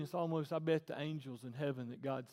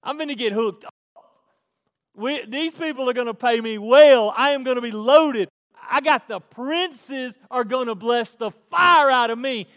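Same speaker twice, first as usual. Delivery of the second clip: whooping, high, very loud glee; hammed-up, shouted gloating; giddy triumph; 10 semitones higher, 23 dB louder than usual. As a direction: crow like you just hit the jackpot. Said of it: "He's almost giddy".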